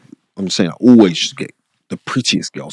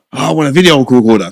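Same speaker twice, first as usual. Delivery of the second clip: higher, louder mimic of a kid begging for a video recorder; mock kid request; 6 semitones higher, 5 dB louder, faster than usual. Imitating schoolmates asking Spencer for things, higher and louder. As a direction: play the mate asking Spencer for a favour.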